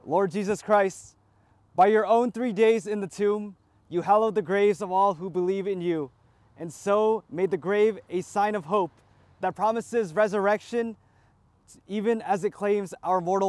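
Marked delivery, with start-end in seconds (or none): none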